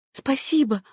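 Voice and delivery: a soft, high thank-you; lightly voiced woman thanking a man; Susanna's thanks. A narrator's reading. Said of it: "сказала Сюзанна, обращаясь к Джону".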